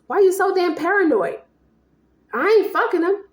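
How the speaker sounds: acted indignant denial. Acted denial in couple's quarrel.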